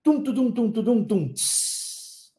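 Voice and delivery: vocal drum roll ending in a cymbal hiss; imitated drum beat. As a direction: play drums with the voice, finishing on "tss". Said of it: "o cara lá da bateria faz tum".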